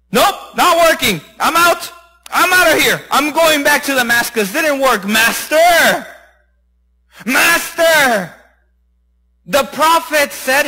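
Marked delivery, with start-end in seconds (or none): 0.1-5.1 higher-pitched, exasperated quitting; fed-up giving up. Acted. Rich man quitting after the first dip, exasperated. 5.1-8.4 drawn-out call to someone leaving. Acted. Servant calling his master back to the river, insistent.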